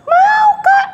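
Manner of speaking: high, loud eager yes; eager puppet girl. Acted. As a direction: shout yes like an excited kid. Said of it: "Mimi mau denger nggak?".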